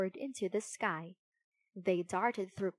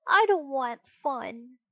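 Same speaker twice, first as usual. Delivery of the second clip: groaning; 4 semitones higher, 7 dB louder, slower than usual. Acted. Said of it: "groaned Pinocchio".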